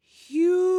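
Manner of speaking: high, eerie forest spirit call; a ghostly voice from the mist. Acted. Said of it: "heard a voice say".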